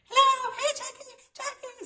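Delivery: high, light voice of a caller; imitated phone caller. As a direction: imitate a chirpy hello down the line.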